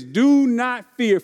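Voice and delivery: drawn-out "Do not fear"; deliberate preacher's reading of the verse; slow emphatic scripture. Acted. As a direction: lean on every word of "Do not fear".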